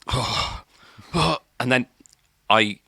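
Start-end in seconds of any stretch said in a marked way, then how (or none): 0.1-1.4 breathy groaning oh, oh before being sick; retching groan. Retold or imitated. To the crowd around him, a mock retching groan. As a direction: heave it out like about to vomit. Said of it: "And I go".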